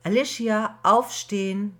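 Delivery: loud wake-up call from the kitchen; mother calling upstairs. Acted. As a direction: call her daughter out of bed from below. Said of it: "ertönte die Stimme ihrer Mutter".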